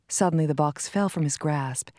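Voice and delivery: ordinary delivery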